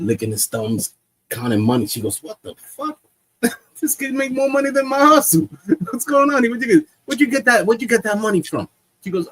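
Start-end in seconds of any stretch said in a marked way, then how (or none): 2.2-8.7 high, loud, incredulous mom; mock outrage. Mom finds son counting money, mock outrage. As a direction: imitate a shocked mother, high and loud, half laughing. Mom shocked by son's cash.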